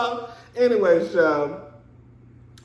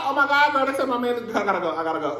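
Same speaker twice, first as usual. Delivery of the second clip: put-on higher, frantic "Oh my God, girl"; mock dramatic panic; 8 semitones higher, much faster than usual. Wife hearing her husband is hospitalized, mock frantic panic. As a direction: ham up the panic of a loving wife. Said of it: "I would've been dramatic".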